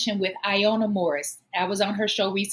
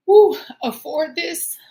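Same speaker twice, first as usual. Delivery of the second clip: emotional woo; 6 semitones higher, 8 dB louder, slower than usual. Real, not acted. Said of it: "I get a little emotional".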